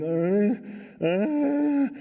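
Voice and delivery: drawn-out, wordless mock moan climbing in pitch; delirious mumbling moan. Stricken climber totally out of it, moaning deliriously. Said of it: "He's mumbling".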